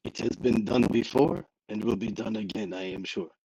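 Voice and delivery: hammed-up French gunman; bad French accent. [name] justifies pointing a gun in public, bad French accent. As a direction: answer in a badly faked French accent. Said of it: "in a very bad French accent".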